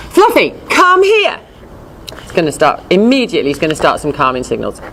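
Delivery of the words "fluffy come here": high, slow insistent call; sterner call. Retold or imitated. Owner repeating a failed recall, slow and high. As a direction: drag out the name, getting impatient. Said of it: "never trained a proper recall".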